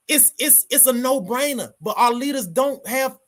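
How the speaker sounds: worked-up rant about lazy leaders; real anger building. Real, not acted. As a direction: let the frustration boil over. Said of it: "oh, I get so angry".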